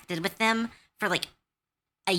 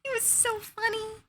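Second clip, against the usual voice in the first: high, laughing delivery. Real, not acted. DM recalling the book trade, still giggling high. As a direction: talk through giggles.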